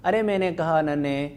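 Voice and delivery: ordinary talk, nothing marked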